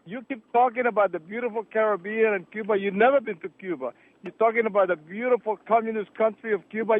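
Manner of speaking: ordinary talk, nothing marked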